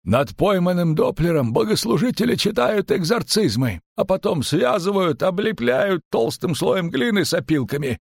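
high-pitched, insistent innkeeper; animated tavern keeper, pitched well up; emphatic innkeeper. A narrator's reading. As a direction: recount the grim custom in a high voice. Innkeeper describes executions.

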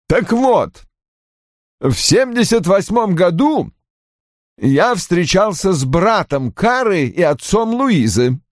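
put-on high-pitched, emphatic older father; Roy's agitated character voice. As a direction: play an indignant father, pitched well up.

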